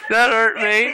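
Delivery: words broken by laughter, long high laugh; can't get through it without laughing. Real, not acted. Owner laughs at the cat's growl, laughing through the words.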